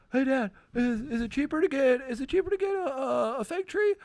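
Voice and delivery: put-on high kid voice. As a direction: ask like an eager boy. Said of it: "my son goes".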